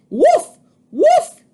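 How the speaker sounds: very high, loud yapping bark; barking like a dog. A narrator's reading. Jack barks at the birds, high and loud. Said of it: "he cried".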